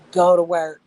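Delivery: sudden loud exclamation; loud and excited. Real, not acted. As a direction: burst out loud on "go to work". Excited about crab meat.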